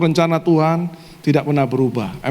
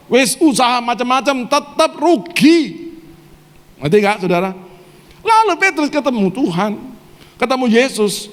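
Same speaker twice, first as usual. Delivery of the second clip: raised-pitch, emphatic sermon; impassioned preaching; 7 semitones higher than usual. Real, not acted. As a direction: pile up the troubles in a high preaching voice. Preaches Peter's troubles with force.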